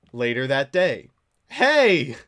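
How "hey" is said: very loud, high mock yell; escalating call-out. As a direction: yell after someone who ignores you. Acting out the same message later.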